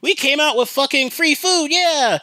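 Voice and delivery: high-pitched imitation of thrilled customers; hammed-up celebratory cheer, pitched well up; mock happy gloating. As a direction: crow triumphantly, as if imitating winners. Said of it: "they go all happy thinking".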